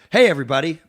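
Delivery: loud upbeat radio-plug voice; mock promo plug. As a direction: hype it like an ad read.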